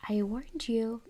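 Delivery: usual speaking voice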